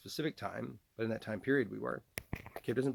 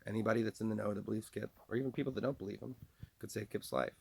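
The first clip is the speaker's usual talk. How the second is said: normal talking voice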